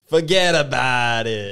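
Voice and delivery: slow, loud Brooklyn "forget about it"; New York accent. Pretend. Friends dare him into a loud New York accent. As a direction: drag it out like a New York wiseguy. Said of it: "give us a little taste of it".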